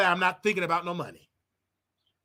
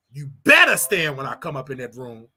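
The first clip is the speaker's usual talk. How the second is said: mock commanding husband; 8 semitones lower, 4 dB louder than usual. Commanding imagined wife.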